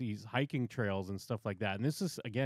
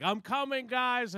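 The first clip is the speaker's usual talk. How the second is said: much higher, bright mock call to the team; cheerful climber; 11 semitones higher, slower than usual. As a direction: play the naive guy calling out happily. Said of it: "he's like".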